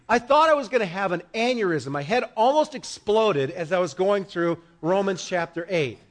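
ordinary talk

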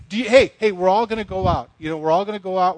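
imitating a loud, pushy pal; eager invitation. Colleagues urging him out for the evening, eager invitation. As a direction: call out loudly to rally the group.

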